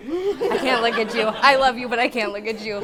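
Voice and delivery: can barely get the words out for laughing; real laughter cracking through an emotional toast. Real, not acted. Breaks up laughing at brother.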